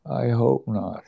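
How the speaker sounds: frail, faint and thin; barely there, a weak high whisper of a voice. Retold or imitated. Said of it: "And in a very weak voice".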